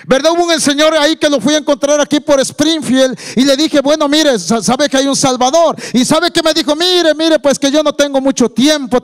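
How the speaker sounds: high-pitched preacher telling an anecdote. Acted. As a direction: tell it in a high, projected pulpit voice.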